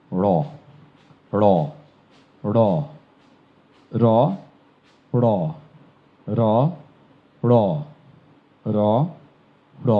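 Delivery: র with the tongue curled back, flapped off the palate; ড়-type র demo. Shown as a demonstration. Teacher drilling the curled-tongue sound. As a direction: flap ড় from the palate. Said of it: "জিহ্বাটি ঘুরে ওইখানে তালুর সাথে গিয়ে বাড়ি দিয়ে উচ্চারিত হবে".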